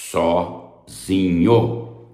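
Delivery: Sozinho very slow, split as so... zinho; drawn out. Shown as a demonstration. Teacher drums in the key condition, Sozinho slowly drawn out. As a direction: stretch each syllable of Sozinho.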